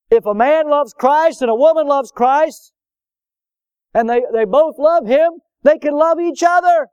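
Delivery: high, loud ringing proclamation; preaching climax. Real, not acted. Preacher to young people, rising to a loud, high proclamation. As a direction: lift the congregation with a loud, high call.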